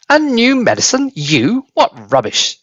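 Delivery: high mocking old woman scoffing; grandma scoffing from the roof; scornful old grandma. A narrator's reading. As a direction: sneer at the idea. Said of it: "she shelled".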